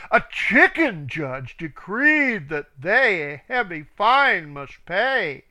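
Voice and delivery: usual speaking voice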